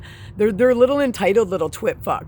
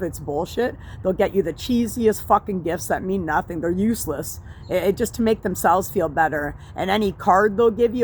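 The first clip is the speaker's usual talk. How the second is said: normal talking voice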